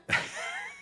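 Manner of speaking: very high squealing giggle; squeaky laugh. Real, not acted. Preacher bursting into a squealing laugh at a joke.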